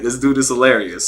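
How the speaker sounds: ordinary talk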